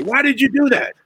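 high, shrill mock yelling; Frank yelling. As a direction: squawk it like an angry puppet boss. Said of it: "he started going off on me".

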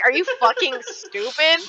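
retelling her comeback to a classmate, incredulous; incredulous retort. Retold or imitated. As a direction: replay the disbelief at a classmate, half-laughing. Retelling her blunt reply.

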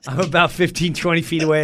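ordinary talk, nothing marked

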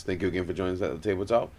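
rattled off fast; rushed. Real, not acted. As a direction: speed through the outro. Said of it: "Why you speed racing through that motherfucker".

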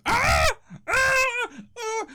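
short high-pitched shrieks; repeated mock shrieks, very high; mock scream. Maggots fall out of the roof, high mock screams.

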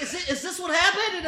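high, loud mock panic; hammed-up anxious questioning; nervous onlooker. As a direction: imitate a flustered, stammering lady. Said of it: "she made me more nervous".

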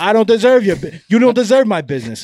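loud, high-pitched outraged customer; hammed-up angry retort; mock indignation. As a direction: play a father arguing with the shop owner. Acted quarrel with pizza man.

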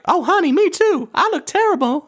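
high, hammed-up diva voice; very high celebrity impression; Tiffany Pollard. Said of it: "worst impression of Tiffany Pollard".

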